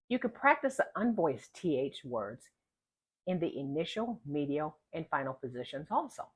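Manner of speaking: ordinary talk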